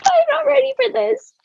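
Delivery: high, fast mock-panicked squeal; panicked protest. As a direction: squeak it out in comic alarm. Acts out her panicked younger self.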